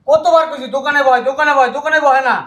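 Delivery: loud, high-pitched exasperated shouting; furious scolding. Acted. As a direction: hammer the repeated order at full voice. Brother furiously scolds the idle one.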